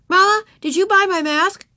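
put-on high, loud child voice; eager little girl. A narrator's reading. Ramona asks Mama about her Halloween mask, eager high child voice. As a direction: pipe up as a keen little kid.

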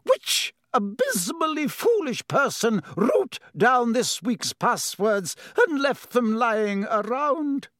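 high, slow, trembling outrage; shaking voice. A narrator's reading. Professor McGonagall demanding who lost the passwords, voice shaking. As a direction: drag out each word, voice shaking. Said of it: "her voice shaking".

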